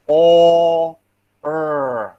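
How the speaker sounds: "o, er" drawn out as two separate sounds; sound-by-sound demo. Shown as a demonstration. Teacher showing how "or" is transcribed, sound by sound.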